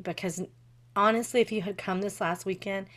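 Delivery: usual speaking voice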